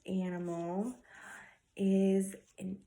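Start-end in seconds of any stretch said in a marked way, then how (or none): none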